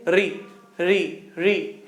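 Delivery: री said repeatedly with the tongue brushing the palate; री demonstration. Shown as a demonstration. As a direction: sound री slowly to feel the contact. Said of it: "री बोल करके देखें". (री का उच्चारण दोहराकर)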